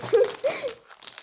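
high loud laugh; burst of laughter. Real, not acted. Laughing at free lollipop.

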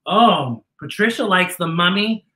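usual speaking voice

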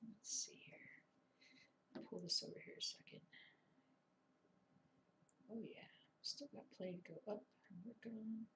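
whisper. Real, not acted. Quiet self-talk while working.

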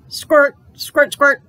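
playful pretend squirting noise. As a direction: make the squirt sound.

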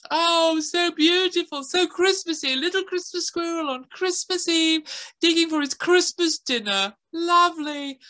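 put-on high, gushing little girl; girl gasping at how festive it is; excited girl voice. A narrator's reading. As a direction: squeal it like a delighted girl. Girl character's voice.

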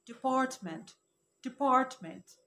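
department said as di-PART-ment, no extra e; correct pronunciation. Shown as a demonstration. Teacher gives viewers the right department. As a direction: model department with the stress on PART. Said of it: "النطق الصح بتاعها".

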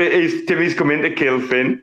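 ordinary talk, nothing marked

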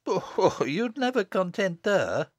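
high-pitched testy mother. Acted. Mother dismissing the little girl, testy high voice. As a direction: put her down testily, up high.

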